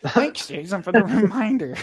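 laughing, sarcastic thank-you. Retold or imitated. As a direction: thank him dryly, cracking up. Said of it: "I'm like".

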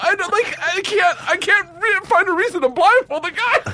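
squealing high through laughter; laughing. Real, not acted. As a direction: laugh out every word, voice cracking high. Laughing while telling story.

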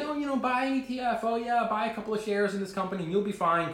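high, put-on mocking voice; patronizing advisor. Wall Street advising the little guy, patronizing mockery. Mocks patronizing establishment investing advice.

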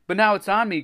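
high, loud whine of dismay; moaning. A narrator's reading. Greg now stuck with the blob, moaning. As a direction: wail it in dismay. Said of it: "Greg moaned".

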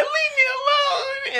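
very high, slow, crying mock plea; mock tearful plea. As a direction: whimper it like someone in tears running off. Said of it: "you crying somehow".

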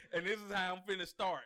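loud, amused, through laughter; laughing while talking. Real, not acted. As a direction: keep talking as the laugh runs on.

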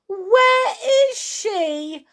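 high, slow, loud wail; frantic high-pitched woman's voice; alarmed cry. Acted. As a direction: cry out in panic, stretching every word. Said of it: "cried Missus Cranky".

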